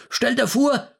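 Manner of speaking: put-on high, worked-up old man; agitated. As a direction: burst out with the news. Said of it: "sagte er aufgebracht".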